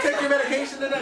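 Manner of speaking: high, fast jab with a laugh in it; imagined snark at an eccentric seller, high and quick; sarcastic retort. Retold or imitated. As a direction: toss it off as a cheeky joke. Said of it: "I was like".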